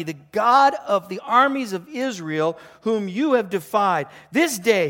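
loud, high, ringing declaration; David's bold defiance. Acted. Preacher reads David answering Goliath, defiant and loud. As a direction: proclaim it forcefully to the enemy. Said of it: "And David said to the Philistine".

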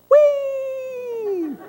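very high, squealing whee; pig squealing whee. Retold or imitated. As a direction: squeal it like a joyful pig sliding downhill. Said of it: "the pig that ran home in Charlotte's Web that went".

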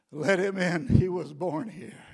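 booming voice. Acted. Heaven's voice calling out to let the believer in, booming. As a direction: boom out like a voice from heaven. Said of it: "that loud voice boom out".

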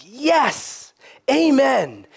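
high, drawn-out exultant cry; hearty agreement. Acted. Preacher voices hearts already there, hearty agreement. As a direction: let each word swoop up in joyful assent.

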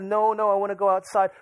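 lightly imitated crying child, high-pitched; mild mock wail to go out; Elijah. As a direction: plead like an upset kid, without real tears. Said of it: "Elijah starts crying".

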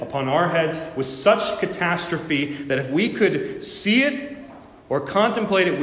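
a preacher pleading with the congregation to repent; full-force preaching. Acted. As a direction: thunder the warning of judgment at the congregation. Preaching climax on judgment.

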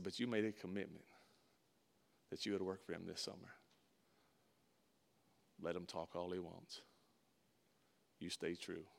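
low, very soft, measured advice; dad laying it down quietly; quiet fatherly counsel. Retold or imitated. Dad holds his son to a commitment, quiet and firm. Dad's steady counsel, retold.

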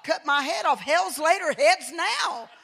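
put-on incredulous boyish outburst. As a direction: blurt it like a shocked child. Boy reacts to beheading threat.

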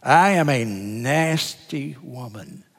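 slow, with "nasty" stretched long; Ashley Judd. Retold or imitated. Pastor mimics how Ashley Judd started out, drawn-out nasty. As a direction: linger on "nasty", imitating an emphatic reading. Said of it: "drew out the nasty part of it".

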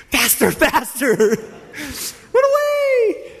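slow, strained cry leaping very high; hammed-up frantic escape, ending in a long high wail; mock panic. Disciples fleeing what they take for a ghost, mock panic.

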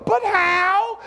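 high, drawn-out protest, rising in disbelief; protesting doubter. Acted. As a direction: act out a listener balking at God. Imagined listener protesting to God.

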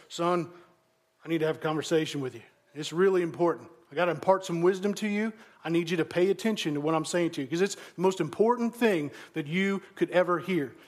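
low-pitched, earnest father voice; imagined serious dad. Acted. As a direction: play a dad solemnly imparting important wisdom. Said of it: "that's how he talks".

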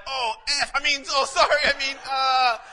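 loud, high, embarrassed stammer; flustered apology. Retold or imitated. Young man caught cussing apologizing to the pastor, flustered and loud. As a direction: blurt it out, caught off guard. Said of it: "this one guy was like".

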